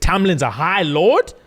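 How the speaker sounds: high, slow, disbelieving question; shocked question. Retold or imitated. She learns who Tamlin is, high and shocked. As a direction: stretch it out in disbelief. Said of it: "And she said".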